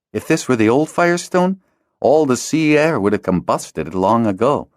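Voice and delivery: dry, amused answer; a lightly voiced Kristoff; Kristoff's wry reply.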